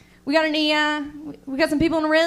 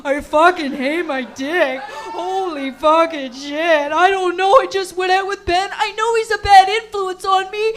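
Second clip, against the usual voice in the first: loud, higher desperate mock guy voice; sincere guy apologizing; 4 semitones higher, 6 dB louder than usual. Cheating boyfriend pleading with crying girlfriend, loud desperate mock voice. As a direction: beg her forgiveness loudly, half laughing. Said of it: "He's like".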